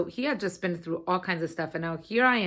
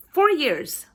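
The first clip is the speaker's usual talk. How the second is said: "four years" punched high and loud; emphatic announcement; 9 semitones higher, 6 dB louder than usual. Real, not acted. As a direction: proclaim "four years", stressing "four". Announcing the anniversary proudly.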